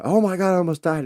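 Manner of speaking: high loud frantic cry; hammed-up panic, way up and loud; panicked rant. Telling wife he almost died, high and frantic. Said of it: "I went off on".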